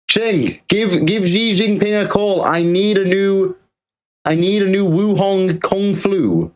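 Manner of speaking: hammed-up shout next door; mock calling out. Streamer shouts to neighbour Ching for a new virus, mock call.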